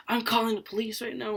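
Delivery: high-pitched mock yell without real volume; strained high voice, held back; retold loud threat. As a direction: imitate someone raising their voice in a row. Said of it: "talking, like, loud".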